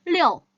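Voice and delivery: 리오 said loud and high; 리오 said brightly as liu; liu demo. Shown as a demonstration. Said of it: "읽을 때는 이오로 읽어주셔서".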